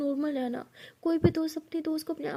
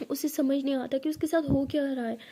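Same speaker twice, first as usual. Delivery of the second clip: usual speaking voice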